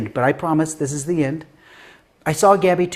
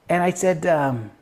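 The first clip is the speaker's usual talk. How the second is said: ordinary talk, nothing marked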